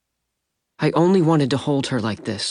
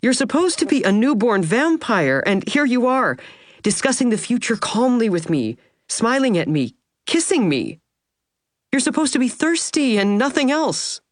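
higher, lightly voiced young woman, amused; delighted young wife; 11 semitones higher than usual. Wife teasing her new vampire husband, amused and higher. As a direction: play her marvelling and teasing, lifted up.

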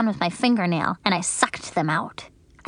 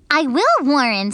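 firm, proud promise; confident; 5 semitones higher, 5 dB louder than usual. A narrator's reading. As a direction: sure of herself. Grace boasting confidently.

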